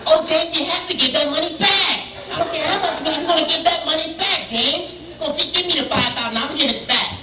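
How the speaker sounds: high, insistent sitcom parody; sitcom character. Acted. Arguing over the five thousand dollars, high hammed-up sitcom voice. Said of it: "Anybody watch Good Times?".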